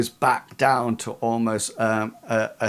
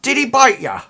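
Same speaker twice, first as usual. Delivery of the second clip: much higher, louder woman's voice; worried Ida; 12 semitones higher, 9 dB louder, faster than usual. Acted. Ida checking on Studley, a high urgent woman's voice. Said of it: "She says".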